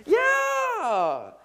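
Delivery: high, loud mocking yeah; hammed-up triumphant shout; mock cheer. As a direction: cheer it like a hyped youth kid. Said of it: "I always make fun of this".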